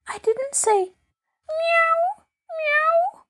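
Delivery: put-on a scared girl trying to explain; scared protest. A narrator's reading. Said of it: "Very much scared".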